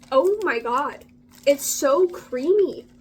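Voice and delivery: loud, slow, overwhelmed gush over the latte; delighted gush. Real, not acted. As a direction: savour it out loud, stretching creamy.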